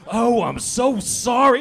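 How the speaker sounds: 1 drawn-out apology. Retold or imitated. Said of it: "They're like".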